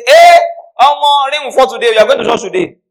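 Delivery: high, very loud exclamation; mock amazement. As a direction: shout it in exaggerated surprise. People exclaiming at churchgoer, acted.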